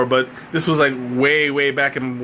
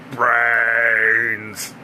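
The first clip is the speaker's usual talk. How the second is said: zombie moan; 3 semitones lower than usual. Acted. Zombie answer to question.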